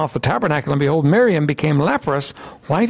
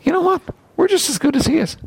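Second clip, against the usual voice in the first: indignant rivals; 8 semitones higher than usual. Retold or imitated. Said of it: "they're beginning to feel".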